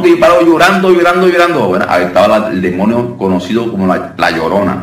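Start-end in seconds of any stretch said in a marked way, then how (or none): 0.0-1.7 higher, louder and quicker, a mock complaint; hammed-up whiny patient, pushed up in pitch; a touch of a tearful sufferer, louder and fast; imitating a weepy patient. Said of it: "Y la persona, no".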